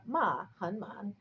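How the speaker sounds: "ma han man" slow, each tone distinct; carefully shape the pitch of "ma han man"; tone tongue twister. Shown as a demonstration. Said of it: "with different intonations".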